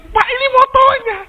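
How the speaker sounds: guard telling the man his motorbike is right there, in a high voice; security guard. Retold or imitated. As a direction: play the guard pointing out the obvious. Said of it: "kata security bilang".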